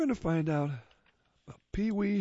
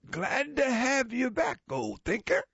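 alien voice; 9 semitones higher than usual. Acted. Alien greets Pedro.

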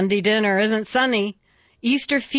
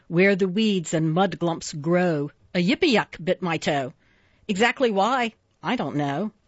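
ordinary delivery

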